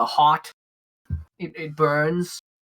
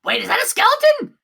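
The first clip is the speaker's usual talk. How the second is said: high, louder kobold barkeep voice; startled bartender; 6 dB louder than usual. Acted. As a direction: blurt it out high in astonishment. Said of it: "the bartender kinda looks at you, Hugh, and goes".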